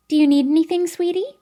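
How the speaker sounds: loud peppy nurse. Acted. The cheerful nurse asks Kiera, peppy and sing-song. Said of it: "her loud, peppy voice".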